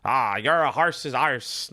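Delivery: slow, badly faked British-Irish accent; terrible British-Irish accent. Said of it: "That's a terrible accent".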